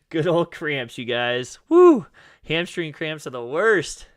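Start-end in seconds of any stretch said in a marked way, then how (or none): 1.7-2.1 loud woo. Real, not acted. Shaking off a cramp.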